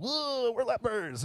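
high, drawn-out mock cheer; rowdy party whoop. Lepers showing off their party in public, rowdy whoop. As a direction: whoop it up like you own the room.

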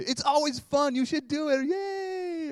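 high, loud fake enthusiasm; sarcastic forced cheer; hammed-up chirpy yay; mock pastor cheer. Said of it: "I guess I should say".

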